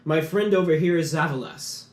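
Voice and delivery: stately gem mage introducing his council; grand gem mage. Acted. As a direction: announce yourselves like a proud magister. GM voices Lalos the Gemomancer.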